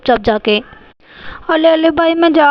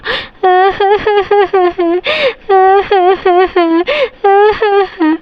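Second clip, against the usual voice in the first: put-on child sobbing; 5 semitones higher than usual. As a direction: cry like a scolded little girl. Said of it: "रोती ही रहोगी".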